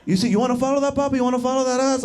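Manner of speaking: hyped-up Dominican street voice; excited cab driver. Retold or imitated. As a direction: egg him on to chase her. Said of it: "he was like".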